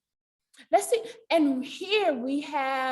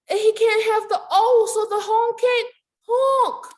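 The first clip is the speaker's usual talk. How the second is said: rising, louder and faster; excited; 9 semitones higher, 5 dB louder than usual. A narrator's reading. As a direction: build it up for the kids. Story excitement.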